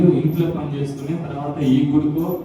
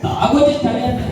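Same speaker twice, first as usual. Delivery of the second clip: laughing; 9 semitones higher, 6 dB louder than usual. Acted. Laughing retort to son.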